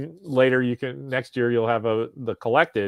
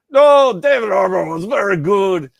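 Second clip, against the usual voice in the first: retold goofy Russian accent, much higher and louder; hammed-up fake Russian accent, half-shouted; dumb Russian accent; 8 semitones higher, 9 dB louder than usual. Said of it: "in my dumb Russian accent".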